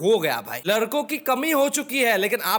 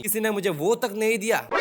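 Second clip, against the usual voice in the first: ordinary delivery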